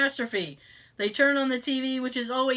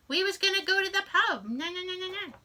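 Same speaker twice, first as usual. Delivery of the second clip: higher-pitched Boston mother, hammed up; mock Boston mom accent; 8 semitones higher, faster than usual. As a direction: chatter like a Boston mom on the phone. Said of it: "Your mom would be like".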